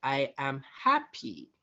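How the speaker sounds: slow, with the weight landing on "happy"; stress on happy. Shown as a demonstration. Coach demonstrating tone of voice, stress on "happy". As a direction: draw out and lean on the word "happy". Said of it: "for example, I can say".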